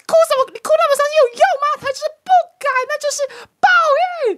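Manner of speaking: high-pitched, loud mock scolding of a crying grandma; bratty little kid snapping at a grieving elder, loud and high; child scolding grandma. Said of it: "你知道我骂什么吗？ 劈头大骂".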